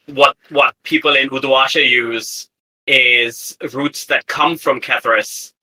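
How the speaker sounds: usual speaking voice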